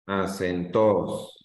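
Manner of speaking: "Acentos" slowly as a-cen-TOOOS, falling; stress on last syllable. Shown as a demonstration. Teacher testing the student's answer, "Acentos" stressed on "tos". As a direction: stretch and hit the final "tos" of "Acentos". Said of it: "voy a leerlo fuerte en donde dices tú".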